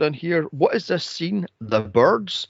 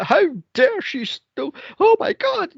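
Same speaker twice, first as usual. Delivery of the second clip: mock outraged villain. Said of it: "She's like".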